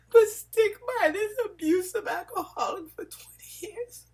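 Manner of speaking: pushing the words out through real sobs; real crying. Real, not acted. Telling viewers she did nothing wrong, crying.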